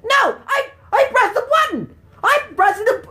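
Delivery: high shouted disbelief; loud gamer outburst; outraged protest. Real, not acted. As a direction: yell at the game in frustration. Enemy hit despite button press.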